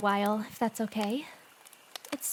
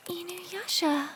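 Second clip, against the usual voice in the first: touched gasp; 7 semitones higher, slower than usual. Acted. Touched by confession.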